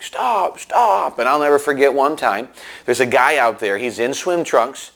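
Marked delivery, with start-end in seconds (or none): 0.0-1.2 high urgent calls to stop; calling stop. Retold or imitated. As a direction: wave a driver off, high and urgent. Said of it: "We're like".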